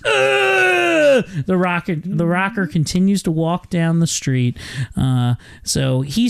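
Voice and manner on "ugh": long, high mock scream falling at the end; imitated scream back. Hero screams back at the kid, high imitated scream. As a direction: scream back in one drawn-out yell. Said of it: "screams back, goes".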